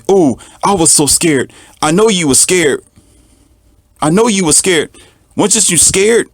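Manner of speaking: high, mocking scaredy voice; imitating someone gushing about being scared; mocking scared person. Mocking people who admit fear.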